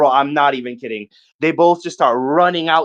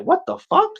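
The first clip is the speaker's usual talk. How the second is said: shocked. Retold or imitated. Homeless guy snatches the money.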